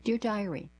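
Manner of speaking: usual speaking voice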